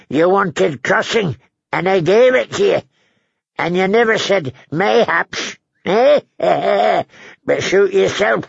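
put-on high-pitched, grumbling old man voice; querulous old lord. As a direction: needle the guest, cackle, then dismiss it.

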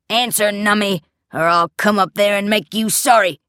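loud, threatening yell; angry shouting. A narrator's reading. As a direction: bark a threat upstairs. Father bawls threats upstairs.

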